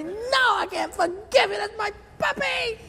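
high mock-tearful wail; distraught puppy owner. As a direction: refuse in a hammed-up squeal. Owner refuses in grief.

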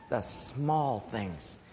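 low, soft and very slow; hushed emphasis. Acted. Preacher naming what keeps marriage strong, hushed emphasis. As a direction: savour each word quietly, drawn out. Contrasts small with big things.

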